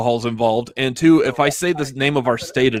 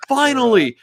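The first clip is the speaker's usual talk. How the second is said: relieved excitement; 11 semitones higher, 3 dB louder than usual. Retold or imitated. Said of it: "I was like".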